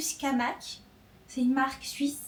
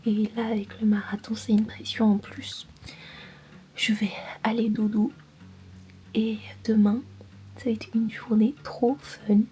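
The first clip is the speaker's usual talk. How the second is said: whispering; 4 dB louder than usual. Real, not acted. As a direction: whisper so nobody wakes. Whispers at night at in-laws.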